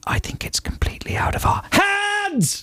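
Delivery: high strained whisper-shout; hiss-shout it at full force; mock shout. Mock shout blowing Steve's windows.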